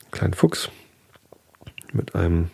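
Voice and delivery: hushed, breathy aside; soft whisper over the picture. Real, not acted. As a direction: whisper it like a secret. Describing the picture to listener.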